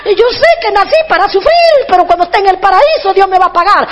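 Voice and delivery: high plaintive lament, easing toward the end; mock crying. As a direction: imitate a self-pitying, tearful preacher. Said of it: "a llorar y decimos".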